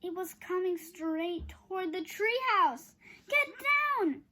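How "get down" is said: high, loud warning yell; urgent cry. A narrator's reading. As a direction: shout the warning as it dives. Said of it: "cried Annie".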